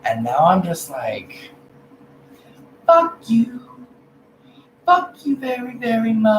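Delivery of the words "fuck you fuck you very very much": high, sing-song fuck you very much; mock sassy fuck-you; sassy dismissal. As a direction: toss it off with cheeky glee. Now I'm just like.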